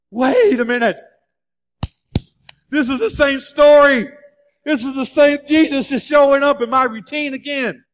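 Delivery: dawning joy, building as it hits; excited realization. Acted. Peter realizes Jesus is calling him, growing excitement.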